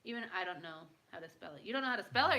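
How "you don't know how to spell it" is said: incredulous. Real, not acted. Incredulous question to chat.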